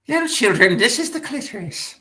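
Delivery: high, hammed-up teacher voice addressing kids; mock sex ed teacher. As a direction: lecture the little children in a silly high voice.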